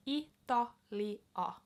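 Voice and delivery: Italia stretched slowly, each syllable clear; slow teaching Italia, ee-TAH-lee-ah; slow demo. Shown as a demonstration. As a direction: draw out Italia for a learner. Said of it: "repeating word for learners".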